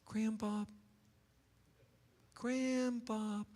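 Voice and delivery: put-on soft, far-off child's call; like a kid calling from the next room; distant muffled child's call of "Grandbob"; calling through walls. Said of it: "the grandchildren's voice".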